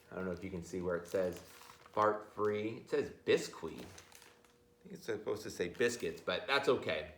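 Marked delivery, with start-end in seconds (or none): none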